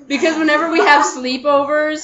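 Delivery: normal talking voice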